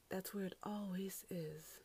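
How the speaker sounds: unvoiced, slow whisper of the same line. Real, not acted. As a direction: whisper it slowly, exasperated. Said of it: "repeated after plain take".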